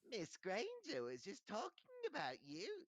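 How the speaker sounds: slow, high impish tattling; sly. A narrator's reading. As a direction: tell on her slyly, dragging it out. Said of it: "said Peeves slyly".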